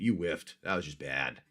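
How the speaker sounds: normal talking voice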